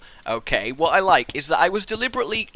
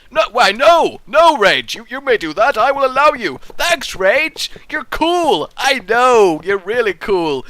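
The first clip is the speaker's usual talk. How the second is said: louder, higher playful second self; other Rage voice; 5 semitones higher, 7 dB louder than usual. Retold or imitated. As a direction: play your own twin cheerfully. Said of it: "And I'm like".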